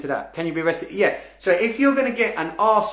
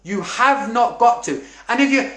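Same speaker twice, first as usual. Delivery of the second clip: normal talking voice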